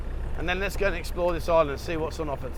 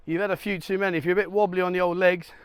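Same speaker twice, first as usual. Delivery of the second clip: ordinary delivery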